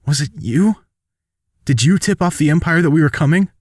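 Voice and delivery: high-pitched, hurt questioning; accusing question. Acted. Wounded soldier questions the traitor, hurt accusation. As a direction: press the betrayer for an answer.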